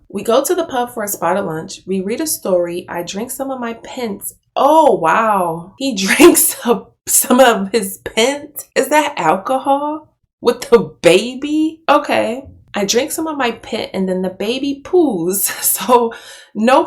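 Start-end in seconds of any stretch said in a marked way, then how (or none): 4.6-12.5 shocked disbelief. Real, not acted. Shocked reaction to drinking with baby.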